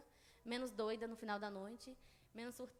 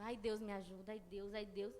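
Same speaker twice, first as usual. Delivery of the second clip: soft moaning "ai, Deus", a touch of a whimper; a worried complaint breathed out softly; whiny lament; 5 semitones lower, 6 dB quieter than usual. Retold or imitated. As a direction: moan it as a fretful lament, not a prayer. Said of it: "mas uma lamúria".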